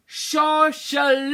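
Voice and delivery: high, loud call of Shosholoza; loud teaching call. Shown as a demonstration. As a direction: call the word out to the group. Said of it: "I'll say them".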